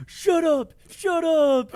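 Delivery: very high, loud disbelieving shout; losing it, thrilled. Retold or imitated. Supervisor told of a raise, ecstatic disbelieving yell. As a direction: imitate someone losing it over good news. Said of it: "he about, like, lost it".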